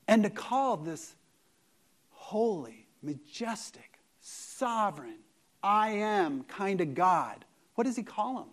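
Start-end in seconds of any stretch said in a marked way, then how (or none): 2.1-5.2 hushed awe. Acted. Hushed awe for God's titles.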